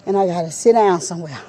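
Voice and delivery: ordinary delivery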